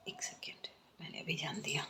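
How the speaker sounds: hushed aside, noticing something; whispered one second, I just noticed. Real, not acted. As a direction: drop to a breath for a private aside. Sudden aside mid reading.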